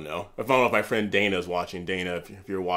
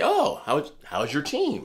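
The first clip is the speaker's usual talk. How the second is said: high-pitched, eager icebreaker; friendly greeting; 7 semitones higher, 3 dB louder, slower than usual. Acted. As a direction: greet a stranger with bright interest, pitched up.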